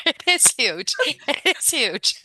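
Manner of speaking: real laughter around the words; laughing agreement. Real, not acted. Listener agrees while laughing.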